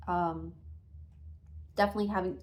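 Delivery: choking after a coughing fit. Real, not acted. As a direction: rush through it with a tickle in the throat. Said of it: "Sorry, I'm choking to death".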